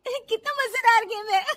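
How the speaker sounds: high, loud, giggly delight; amused laughing. Acted. Daughter-in-law enjoying the TV game, laughing. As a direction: say it through giggles.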